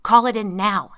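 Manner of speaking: loud commanding order. A narrator's reading. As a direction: bark the order. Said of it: "Henry ordered".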